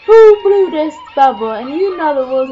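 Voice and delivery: loud, high demanding shout; imitated bully shouting at the room; big tough guy. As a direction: boom it out as an accusation. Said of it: "the big tough guy says".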